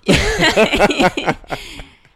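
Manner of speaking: big burst of laughter; loud laugh. Real, not acted.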